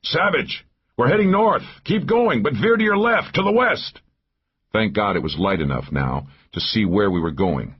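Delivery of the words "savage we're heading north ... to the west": high, urgent shouted orders; raised-pitch forceful command. A narrator's reading. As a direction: call directions to someone at a distance. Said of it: "turned away from him and shouted".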